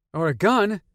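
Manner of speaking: put-on eager, high and loud boy; a boy excited about a gun. A narrator's reading. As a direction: blurt it out, thrilled. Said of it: "Peter said with excitement".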